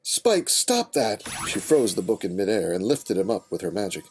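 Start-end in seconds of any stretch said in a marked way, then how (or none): 0.0-1.2 sharp command. Acted. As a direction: stop him at once. Stopping Spike.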